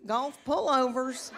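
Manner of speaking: normal talking voice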